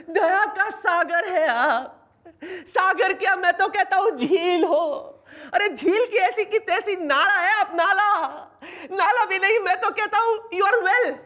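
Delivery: high, overexcited flattery; gushing praise. Acted. Boss praising woman who agreed to help, hammed-up gushing.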